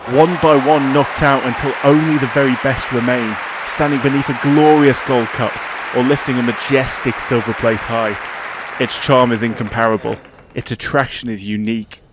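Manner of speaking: dramatic scripted intro read over music; documentary narration. Acted. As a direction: read it like a sports documentary voiceover. Scripted podcast cold open.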